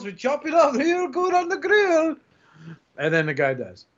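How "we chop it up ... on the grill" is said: high put-on deli cook voice, giggling; Aki impression. Host imitates Aki making a sandwich with rat poison. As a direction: cheerfully rattle off the poisoned order. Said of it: "Just be like".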